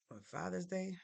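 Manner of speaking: ordinary talk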